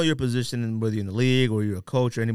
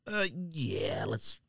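softer, offhand imitation of a league social media monitor; lightly voiced league employee, mumbled much softer; mock NFL staffer; 3 semitones lower, 8 dB quieter than usual. Mocking the NFL's social media watcher for the panel: soft, offhand mock staffer voice. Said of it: "N F L has somebody on there like this".

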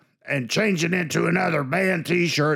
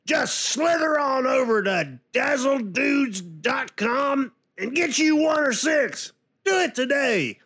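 announcer voice; 8 semitones higher than usual. Acted. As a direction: sell it like a radio ad. Podcast ad announcement.

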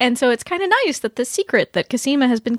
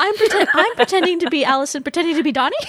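laughing; 7 semitones higher, faster than usual. Real, not acted. Laughing while joking about clones.

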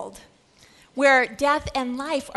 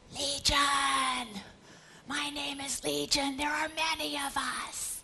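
hushed, sinister demon whisper; many-spirited demon, half whispering; 8 dB quieter, slower than usual. Acted. Acting the demon Legion's reply.

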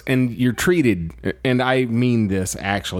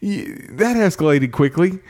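baffled disbelief; 11 semitones higher, slower than usual. Real, not acted. Mock disbelief at escalating scene.

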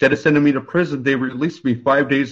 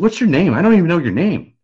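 higher and louder, bursting with amazed gratitude; excited amazement; 6 semitones higher, 5 dB louder than usual. Retold or imitated. Grateful defendant amazed, asking his lawyer his name. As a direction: blurt it out, astonished and grateful.

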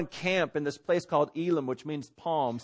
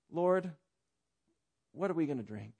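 trusting request; 7 dB quieter than usual. Acted. Preacher shows the other way of asking the Lord, humble trust. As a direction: ask quietly, trusting the answer will come. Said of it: "there's two ways of saying".

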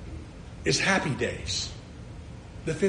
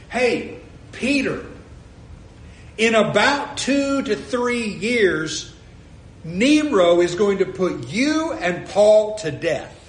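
raised, slow, emphatic call to Peter; mock warning to Peter; 4 semitones higher, 6 dB louder, slower than usual. Preacher warns Peter of Nero, raised and slow.

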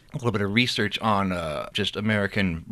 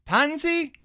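put-on much higher calling a child's name. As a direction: call across the hold to the little girl. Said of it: "doing a multitude of voices".

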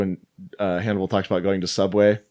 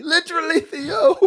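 very high, hammed-up comic impression; badly faked accent sliding into a celebrity voice; Bill Cosby. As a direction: squeal it as a mock impression, breaking into laughter. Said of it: "I sound like Bill Cosby".

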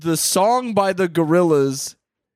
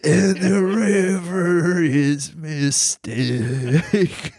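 Clint Eastwood impression; 2 semitones higher, much slower than usual. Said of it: "Clint Eastwood sound like [name]".

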